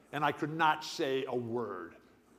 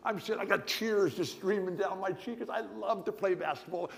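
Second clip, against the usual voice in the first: ordinary delivery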